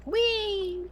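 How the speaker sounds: high, gleeful whee; playful squeal. Real, not acted. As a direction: squeal like on a slide. Playful cheer after fixing issue.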